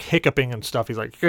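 ordinary delivery

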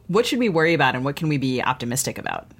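ordinary delivery

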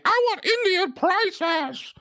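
very high, drawn-out mock shriek; mock screaming. Co-host mimicking a shopper screaming at a vendor, mock screaming. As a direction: screech it slowly as a high-pitched imitation. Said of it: "Instead of just screaming".

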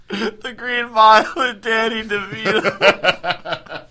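slow and high through real laughter; cracking up, words drawn out high; laughing. Real, not acted. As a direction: wheeze the joke out while laughing. Repeats joke while cracking up.

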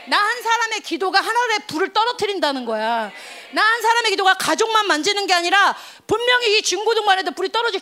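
high, loud fiery sermon; fervent preaching. Acted. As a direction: preach with rising fire. Preaching at full force.

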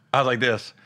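ordinary talk

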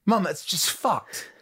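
put-on high, drawn-out English-accented protest; hammed-up English accent, high and slow; mock English accent. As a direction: play a son complaining in a mock English accent. Interviewer acts son talking to mum.

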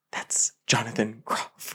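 hushed whispered aside. Retold or imitated. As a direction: whisper it so he won't hear. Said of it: "I said".

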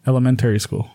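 "Elementary" said with an added r, like "elemen-terry"; "Elementary school" with a strong r in the middle; extra r sounds. Real, not acted. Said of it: "What the fuck?".

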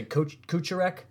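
Kucherek tried as KOO-chuh-rek, ch in the middle; co-host's pronunciation. Retold or imitated. Host checks the co-host's way of saying the name, Kucherek with ch, questioning. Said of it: "you say".

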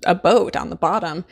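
ordinary talk

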